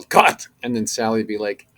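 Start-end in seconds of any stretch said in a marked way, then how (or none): 0.0-0.5 loud, high call of cut; director's yell. Retold or imitated. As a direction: bark it out like a director ending the take. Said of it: "Guillermo would yell".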